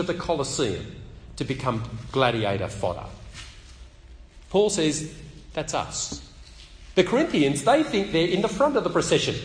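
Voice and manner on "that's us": whispered. Retold or imitated. Paul's stark self-description.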